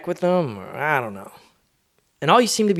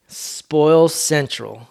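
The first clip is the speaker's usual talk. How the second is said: slow naming; 2 semitones higher, much slower than usual. Real, not acted. Naming the grandparents' house, very slow and deliberate. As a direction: stretch out Spoil Central very slowly. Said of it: "That's what I call".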